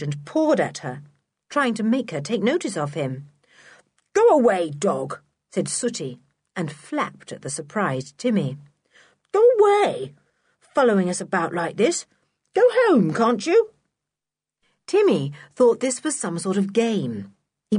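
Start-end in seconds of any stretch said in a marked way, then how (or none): 4.1-5.2 loud command, dropping at the end; shooing a dog. A narrator's reading. Sooty pretending Timmy is a stray, loudly shooing. 9.3-13.7 loud, high, cross shouts; shooing a dog. A narrator's reading. Sooty telling Timmy to go home, shouting crossly.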